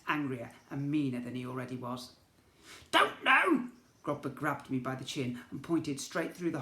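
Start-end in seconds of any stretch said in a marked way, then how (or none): 2.9-3.7 high, loud mocking goblin echo; angry goblin echo. A narrator's reading. Goblin prince mocking the boy's answer, high and loud. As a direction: throw the words back at him.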